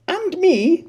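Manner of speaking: squeak. Acted. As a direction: squeak it out hopefully. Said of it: "squeaked Harmon".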